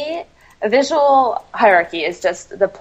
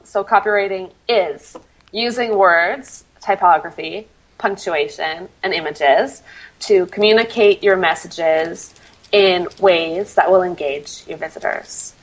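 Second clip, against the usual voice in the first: slow, measured repeat for note-taking; deliberate dictation pace, phrase by phrase; slowly, point by point. Shown as a demonstration. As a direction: say it slowly, pausing after each item. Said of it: "say it a little more slowly".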